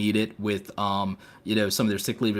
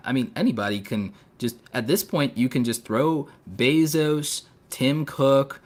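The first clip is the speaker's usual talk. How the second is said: ordinary delivery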